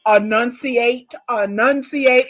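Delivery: "enunciate" slowly, every syllable crisply separated; exaggerated enunciation. Shown as a demonstration. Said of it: "knows how to talk".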